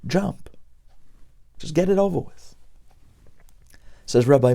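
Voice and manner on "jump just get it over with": soft, high tempting inner voice; screaming inner voice, mimed softly. Acted. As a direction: mimic a scream in a small, hushed voice. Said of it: "a voice that screams".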